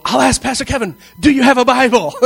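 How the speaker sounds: high, loud eager question; eager cop. Retold or imitated. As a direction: ask hopefully for a Bible. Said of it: "I just go".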